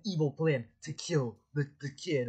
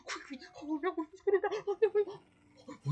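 very high panicky squeal; hammed-up panic. Viewer fears the wolf will kill him, squealed panic. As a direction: squeak it out in mock alarm.

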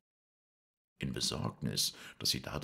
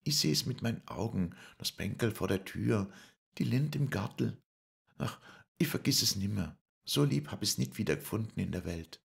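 much higher, longing voice; Lisei's voice; 9 semitones higher, 4 dB louder than usual. Acted. Lisei remembers Paul's home, much higher longing voice. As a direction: recall home in a high, wistful voice. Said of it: "Als sie das sagte".

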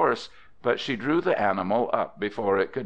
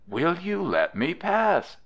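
put-on high, small girlish plea; lightly voiced timid request, pitched high; 9 semitones higher than usual. A narrator's reading. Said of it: "in a very small voice".

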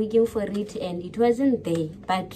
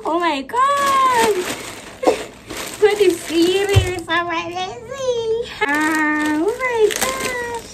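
much higher, slow, crying out in joy; overwhelmed with emotion; 10 semitones higher, slower than usual. Real, not acted. Vlogger unboxing a parcel from the UK, crying with joy. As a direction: squeal through happy tears. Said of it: "I feel so emotional".

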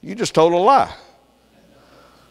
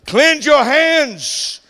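preaching; 10 semitones higher, much slower than usual. Acted. Preaching scripture forcefully.

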